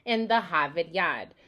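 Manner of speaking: non-rhotic Boston slogan, R's dropped; broad Boston "pahk the cah"; Boston accent. Shown as a demonstration. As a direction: say it like an old-school Bostonian. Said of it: "we hear this slogan called".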